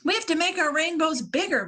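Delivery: high, fast eager plan; urgent crayon. Acted. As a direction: rush it out eagerly. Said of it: "Violet said".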